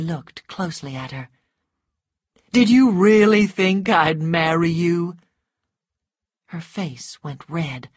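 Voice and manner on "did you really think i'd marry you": loud, incredulous challenge; scornful. A narrator's reading. Rhett asks whether Scarlett expected marriage, scornful. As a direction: throw the question out forcefully.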